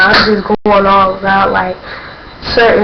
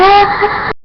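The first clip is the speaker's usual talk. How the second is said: high little squeal; excited squeal; 11 semitones higher than usual. Retold or imitated. Fans smiling at each other nearby, squealing happily.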